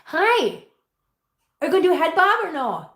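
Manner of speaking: high, quick appeal to a cat; coaxing pet talk. Real, not acted.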